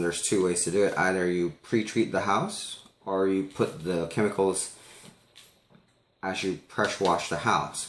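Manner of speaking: usual speaking voice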